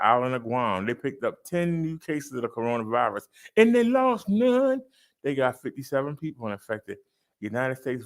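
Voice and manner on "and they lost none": high, loud and slow, crowing it; triumphant catchphrase. Acted. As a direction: sing out the good news like a victory call. Catchphrase on zero deaths.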